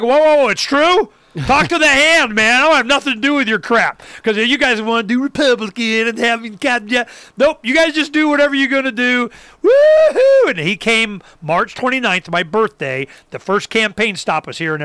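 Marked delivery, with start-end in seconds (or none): 0.0-9.3 higher, louder, dismissive talk to the hand; defiant brush-off. Retold or imitated. Telling the campaign staffer to back off, cocky defiance. 9.6-10.5 very high, loud triumphant whoop. Real, not acted. Celebrating his own defiance, high whoop. Cheering after defiant story.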